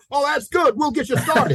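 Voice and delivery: a high mock pastor, all cheerful approval. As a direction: play the pastor waving him right through. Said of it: "and say".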